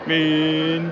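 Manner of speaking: usual speaking voice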